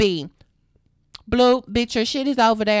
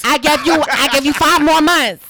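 louder, hammering the point home; loud emphatic; 4 semitones higher, 8 dB louder than usual. Real, not acted. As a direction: raise your voice, fed up. Angry outburst at sister.

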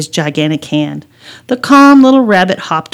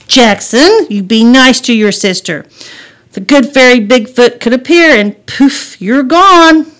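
put-on higher, louder warning to a child; motherly scolding; 5 semitones higher, 6 dB louder than usual. Mother warning son to be nice, scolding. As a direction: scold with a storybook threat.

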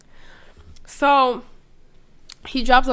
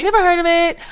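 higher, louder "Never heard of it." in a put-on voice, NEVER hit hard; mock dismissive; 8 semitones higher, 6 dB louder, faster than usual. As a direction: brush it off with exaggerated disdain. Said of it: "He's like".

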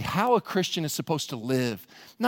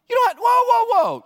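shouted, much higher burst; sudden outburst; 8 dB louder than usual. Acted. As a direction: startle the room, then pull back. Preacher mimics a sudden outburst.

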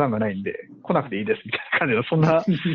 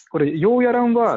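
put-on higher, light Kansai accent refusal; Kansai dialect; 9 semitones higher, 3 dB louder than usual. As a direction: brush it off in mock Kansai accent. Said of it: "関西弁で言ったりする".

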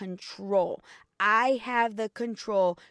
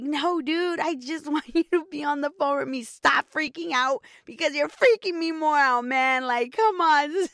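higher and louder, exasperated plea; retold exasperation, pitched up and loud; 6 semitones higher, 5 dB louder than usual. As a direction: snap at a panicking husband to just stay on the line. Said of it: "And it was like".

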